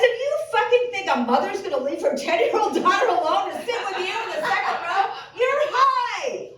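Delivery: high, loud, laughing disbelief; incredulous laughing rant. Retold or imitated. Comic telling Larry off through laughter. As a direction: scoff at him, cracking up.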